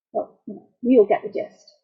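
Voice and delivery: usual speaking voice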